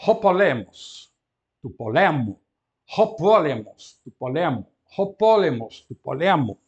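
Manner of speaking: ho POlemos, tu poLEmo, each loud and deliberate; drilled alternation. Shown as a demonstration. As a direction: chant the pair to show the stress shift. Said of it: "reparem nesta alternância".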